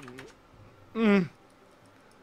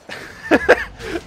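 high, breathy burst of laughter; sudden laugh; 4 dB louder than usual. Real, not acted. Player realizing why the enemy survived, sudden laugh.

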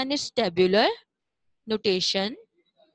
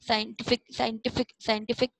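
Scientific run together and repeated briskly; quick reading. Shown as a demonstration. Teacher showing pupils the joined-up word, quick reading. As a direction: rattle off scientific as one whole word, repeatedly. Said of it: "अब इसको जल्दी जल्दी read करें".

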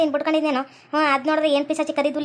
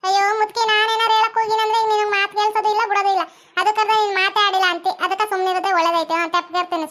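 slightly high, put-on boss voice; exasperated farm boss; 4 semitones higher than usual. Farm boss giving up on chatty old woman, exasperated. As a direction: give up on her with mild exasperation.

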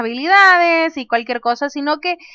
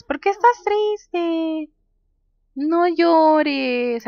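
slow, high comforting coo; drawn-out, pitched-up mock consoling; playful cooing; 4 semitones higher, much slower than usual. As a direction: coo to a sad little one. Comforting a sad game character.

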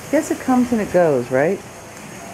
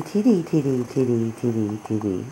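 usual speaking voice

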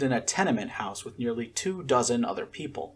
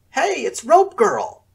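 much higher, louder, slow mocking sing-song; teasing taunt; 13 semitones higher, 9 dB louder, slower than usual. A narrator's reading. Josiah mocking Scarlet in the hallway, loud teasing. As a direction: taunt her like a playground bully. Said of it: "Josiah teased".

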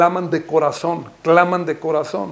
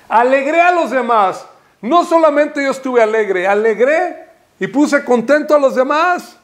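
high, raised exhortation; impassioned preaching; 8 semitones higher, 3 dB louder than usual. Real, not acted. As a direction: drive the point home fervently. Preaching at full force.

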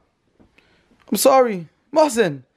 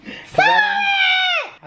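very high, very slow "I don't"; much slower than usual. Real, not acted. Baby talk to Ali.

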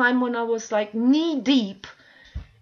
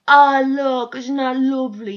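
louder, cooing mimicry of gushing viewers; mock gushing; 5 dB louder than usual. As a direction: coo over a dog picture, mocking it. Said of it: "everybody goes".

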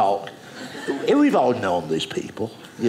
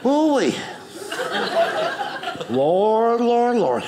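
heavy man sighing; 12 semitones higher, slower than usual. Retold or imitated. Comedian voicing an overweight man, exasperated. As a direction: groan it out like a tired big man. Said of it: "He should go like a".

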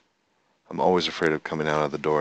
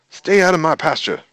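high, loud shoo; shooing the cows; 11 semitones higher, 11 dB louder than usual. Real, not acted.

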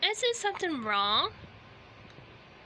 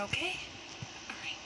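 ordinary delivery

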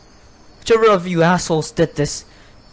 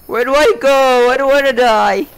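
louder, rising panicked plea; panicky repeat; 4 semitones higher, 4 dB louder than usual. Real, not acted. Player lost in the dark, panicky. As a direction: blurt it again in rising fear. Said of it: "Why do I keep saying that?".

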